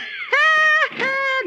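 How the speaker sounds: high triumphant cackle; witch cackle. Acted. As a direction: cackle like a witch closing the trap. Witch springs her trap.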